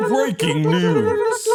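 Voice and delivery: slow, put-on newsreader; mock news intro. Host opening the news segment as a slow mock anchor. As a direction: drag out each word like a broadcast open.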